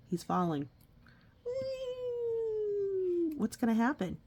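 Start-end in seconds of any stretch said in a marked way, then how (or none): 1.5-3.3 long high gleeful whee; playful whee. Pretend. Parent voicing the falling bat for the child, gleeful. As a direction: swoop up like a falling baby bat.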